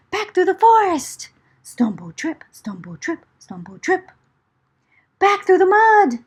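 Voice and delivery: fast, high, breathless flight; frantic retreat; panicked rush. A narrator's reading. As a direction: hurry as if chased. Fleeing the bear.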